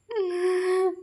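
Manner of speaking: sudden high giggle; high burst of laughter. Real, not acted. Amused at Puja's dizziness, high laughter.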